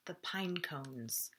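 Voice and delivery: slow soft whisper; whispered title. A narrator's reading. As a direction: hush it out slowly. Chapter title read aloud.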